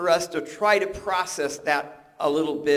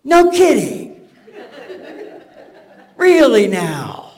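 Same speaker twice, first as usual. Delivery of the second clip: much higher, louder, slow sarcastic retort; sarcastic wife; 10 semitones higher, 12 dB louder, slower than usual. Retold or imitated. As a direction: drip with disbelief, drawn out and high. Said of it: "Missus Manoah probably said".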